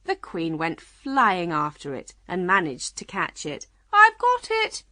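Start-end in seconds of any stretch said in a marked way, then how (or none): 3.9-4.8 high, loud triumphant call; happy call. A narrator's reading. Queen catching her runaway shawl, happily calling. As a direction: call out in delight. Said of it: "she called happily".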